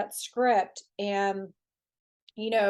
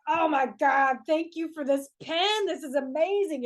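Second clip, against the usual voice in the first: mock gushing; 5 semitones higher than usual. Gushing gratitude to a pen.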